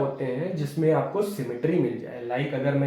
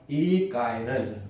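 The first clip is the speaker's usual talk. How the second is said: slow, lower-pitched teaching answer; drawn-out key term; 6 semitones lower, 4 dB quieter, much slower than usual. Real, not acted. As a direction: stretch it out, letting the pitch fall. Teacher repeats answer after asking again. (धीरे, नीचे सुर में खींचकर)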